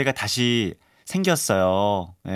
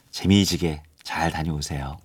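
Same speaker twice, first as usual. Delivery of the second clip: ordinary delivery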